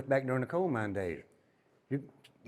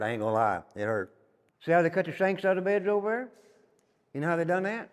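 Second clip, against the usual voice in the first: ordinary talk, nothing marked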